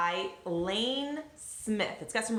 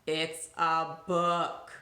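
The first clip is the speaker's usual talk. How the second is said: slow, spaced-out "It's a book"; lower, deliberate word-by-word delivery; emphatic punchline; 4 semitones lower, much slower than usual. Acted. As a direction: draw out each word as the punchline. Final punchline to the donkey.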